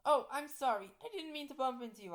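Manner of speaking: high, loud apology; apologetic. Acted. Teacher bumps into a ghost girl, hurried apology. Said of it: "I apologize".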